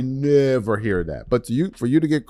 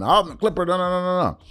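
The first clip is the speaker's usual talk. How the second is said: hammed-up holler in a raised pitch; mock hollering; 8 semitones higher than usual. Sports host mimicking a fan after a Clippers loss, mock hollering.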